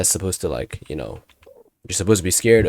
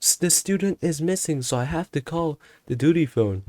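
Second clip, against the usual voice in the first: high-pitched put-on teacher voice; teacher explaining; 8 semitones higher than usual. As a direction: mimic the teacher justifying it, pitched up. Voices teacher's joking justification.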